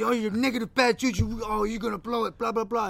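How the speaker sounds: high, jeering imitation of an angry friend; angry mocking. Imitating friend who mocks Ben, angry and jeering. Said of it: "mocking him, and he's mad".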